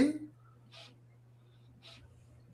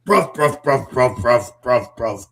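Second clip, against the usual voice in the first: louder mock Cockney, chanting bruv; hammed-up Cockney bloke repeating bruv; playful Cockney impression, bruv over and over; 8 dB louder, slower than usual. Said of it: "too much of a Cockney accent".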